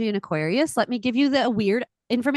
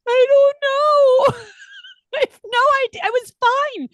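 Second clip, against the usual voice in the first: mock wail; 7 dB louder than usual. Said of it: "I was like".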